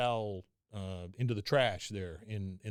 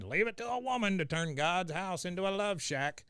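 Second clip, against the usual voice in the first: Cotton voice; 7 semitones higher than usual. Retold or imitated. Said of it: "he said".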